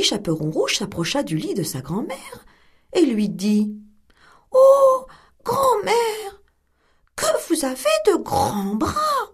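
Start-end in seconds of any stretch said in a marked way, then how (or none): 4.5-9.3 put-on much higher, amazed child's voice; girl staring at granny in bed; wondering little girl. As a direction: marvel like a puzzled little girl. Girl astonished.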